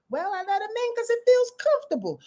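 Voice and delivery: high, innocent voice making excuses; sheepish excuse. Acted. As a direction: defend yourself with a shrug. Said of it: "Pluto answers Aquarius".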